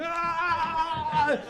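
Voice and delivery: put-on high, shrill child's shriek of rage; mock toddler tantrum scream. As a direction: squeal like a sugared-up kid throwing a fit. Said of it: "full of sugar and anger going".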